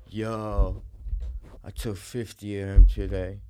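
slow, low, heavily drugged drawl; doped-out guy. Retold or imitated. Doped-out friend tells buyer what he took, drowsy drug slur. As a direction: drag it out like you're heavily doped. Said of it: "He's like".

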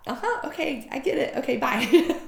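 high, fast, flustered yep-okay-bye; flustered brush-off. Retold or imitated. To her mentor, flustered high rush to leave. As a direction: hurry out before tears come. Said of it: "I was like".

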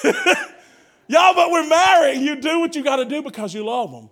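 ordinary delivery

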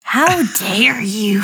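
mock-offended whisper. Mock offence after being teased.

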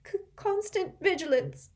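high, teary stutter; a weepy girl quoting her mentor; tearful stammer. A narrator's reading. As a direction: stammer it out through tears. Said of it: "mopping her eyes".

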